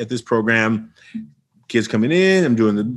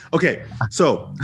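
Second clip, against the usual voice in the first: ordinary talk, nothing marked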